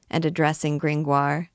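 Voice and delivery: usual speaking voice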